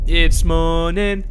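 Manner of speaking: slow announcement. Acted. Announcing morning slowly.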